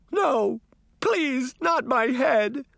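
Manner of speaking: high-pitched terrified plea; tearful begging. A narrator's reading. Deputy in tears before the Horseman, begging. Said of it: "he begged".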